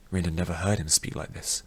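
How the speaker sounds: ordinary delivery, nothing marked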